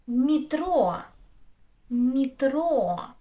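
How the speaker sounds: "метро" as mee-TRO with long final o; unstressed е as и. Shown as a demonstration. Teacher giving an example, "метро" as mee-TRO. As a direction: stretch the stressed end of "метро", ee in front. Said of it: "Я is not stressed".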